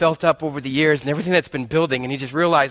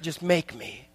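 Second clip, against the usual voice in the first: soft, hushed surrender; humble plea; 8 dB quieter, much slower than usual. Acted. Sinner begging God softly to make him. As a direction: give yourself up quietly to God.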